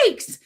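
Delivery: high startled yelp; comic shrieked exclamation; re-enacted startled shriek. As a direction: act out a sudden fright. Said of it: "Like, that's what I did".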